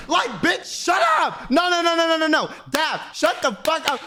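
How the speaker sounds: high, yelled shut up; shouting at Daph. Real, not acted. Telling friend Daph to shut up, exasperated shout. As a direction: shout her down in disbelief.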